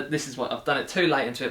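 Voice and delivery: ordinary talk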